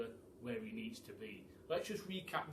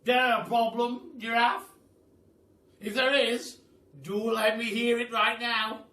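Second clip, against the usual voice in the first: higher, loud, booming praise; old Duke praising the animals, loud and higher; 8 semitones higher, 13 dB louder than usual. A narrator's reading. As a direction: hail them like a grand old duke. Duke voiced in the story.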